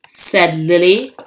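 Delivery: ordinary delivery, nothing marked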